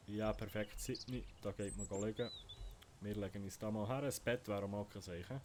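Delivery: normal talking voice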